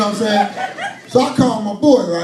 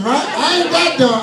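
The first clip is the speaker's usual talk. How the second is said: normal talking voice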